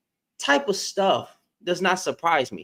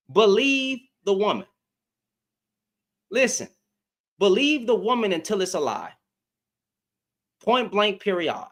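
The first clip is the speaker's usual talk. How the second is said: normal talking voice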